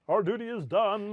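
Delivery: big, loud, high game-show announcer; Rod Roddy voice. Pretend. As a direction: boom it out like a TV announcer. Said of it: "best Rod Roddy voice".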